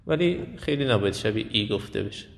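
ordinary delivery

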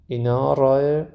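ordinary delivery

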